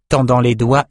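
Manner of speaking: plain reading voice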